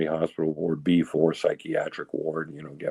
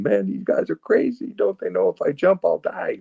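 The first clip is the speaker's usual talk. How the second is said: incredulous; 14 semitones higher than usual. Retold or imitated. Acting out his disbelief on the bridge.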